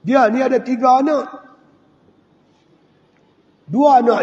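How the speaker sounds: preacher lifting his voice for a moving story; impassioned sermon storytelling. Acted. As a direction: carry the congregation through the tale with rising fervour. Preacher retells a heroic story.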